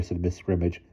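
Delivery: plain reading voice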